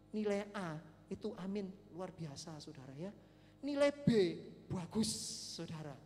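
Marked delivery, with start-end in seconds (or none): 3.6-5.1 comic announcement. Real, not acted. Preacher lists acceptable grades to congregation, announced loudly. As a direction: announce the grade with lifted pitch.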